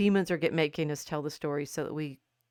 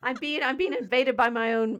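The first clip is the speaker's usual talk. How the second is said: mock alarm; 7 semitones higher, 5 dB louder than usual. Mock alarm over own hair.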